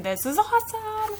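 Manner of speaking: high, breathless thrill from the cockpit; excited. Acted. Pilot thrilled up in the sky, excited. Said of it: "'cause she's so excited".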